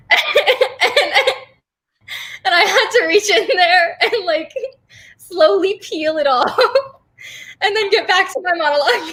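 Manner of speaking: real laughter breaking into the story; laughing while telling. Real, not acted. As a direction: laugh your way through the embarrassing bit. Laughing through embarrassing audition story.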